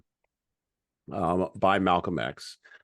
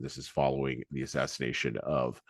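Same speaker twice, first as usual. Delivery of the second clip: ordinary talk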